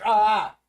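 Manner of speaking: loud "ah" of disgust; disgusted cry. Real, not acted. Streamer tasting Old Bandage bean, loud disgusted cry. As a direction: cry out at a foul taste.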